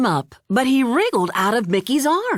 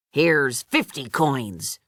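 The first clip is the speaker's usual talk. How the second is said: lower, slow stingy mayor voice; frowning greedy mayor; 7 semitones lower, slower than usual. A narrator's reading. Greedy mayor shortchanging the Piper, lower grudging voice. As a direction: grudgingly hand over too little. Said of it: "the greedy mayor frowned".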